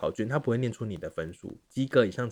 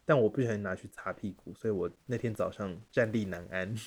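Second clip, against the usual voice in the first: ordinary delivery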